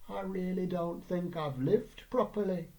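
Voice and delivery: slow, soft-spoken wistful regret; a touch of longing, taken slowly. Retold or imitated. Mum confessing to her son, slow and wistful. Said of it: "she said, wistfully".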